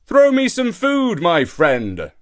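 high, loud call dropping on the last words. A narrator's reading. Shark calls up to monkey in the tree for food, calling out. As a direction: call up to someone overhead. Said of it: "and call out".

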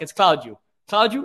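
"Claudio" in two beats, CLOUD-yoo; Portuguese-style "Claudio" ending in "dyoo"; Portuguese pronunciation. Shown as a demonstration. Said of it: "like you'd say it in Portuguese".

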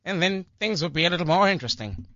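1950s radio voice. Retold or imitated. Host showing the guest a mild fifties announcer voice. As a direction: lightly mimic a fifties broadcaster. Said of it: "they talked a little like this".

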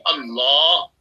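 "Allah" said loud with a long, drawn-out second syllable; proper pronunciation. Shown as a demonstration. Showing the co-host how to say God's name, "Allah" pronounced fully and properly. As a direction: pronounce "Allah" fully, stretching the final vowel. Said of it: "pronounce it properly".

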